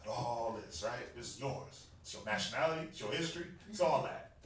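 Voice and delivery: hammed-up low-key pickup line; thirsty man sweet-talking. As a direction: lean in and sweet-talk her like a thirsty brother. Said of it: "Trying to sound thirsty".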